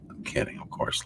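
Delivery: whispered aside, very soft; whispered to himself after a joke. Real, not acted. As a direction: breathe it out under your breath. Repeats it under his breath.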